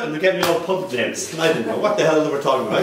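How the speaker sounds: ordinary talk, nothing marked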